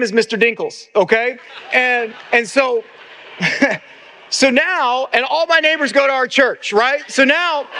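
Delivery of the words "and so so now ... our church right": usual speaking voice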